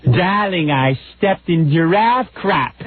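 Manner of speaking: put-on slow, high affected Boston accent lady; snooty mother-in-law voice. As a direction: drawl it like a pampered socialite. Mocking mother-in-law in Africa.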